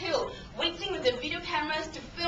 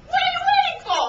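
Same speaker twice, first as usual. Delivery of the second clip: loud, high imitated yell; impatient shout; 10 dB louder than usual. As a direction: holler at someone stalling, acted out. Guy shouting at hesitant jumper.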